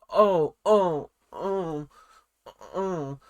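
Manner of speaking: hesitant mock ohs with a pause; awkward stalling. Imitating traitors asked whom they suspect, awkwardly stalling. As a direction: stall awkwardly, as if put on the spot. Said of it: "it sometimes just gets a little bit awkward".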